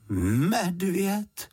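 high, drawn-out questioning "Medvěd?"; doubtful echo. A narrator's reading. Housekeeper repeats what Juditka told her, doubtful. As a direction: repeat "Medvěd?" as if unconvinced, stretching it. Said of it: "opakovala paní Ptáčková pochybovačně".

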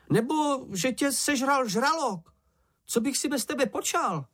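very high-pitched, distressed wail; panicked cry. A narrator's reading. Čenich thought Mumínek had drowned, high panicked cry. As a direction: cry out in a small frantic voice. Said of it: "křičel Čenich".

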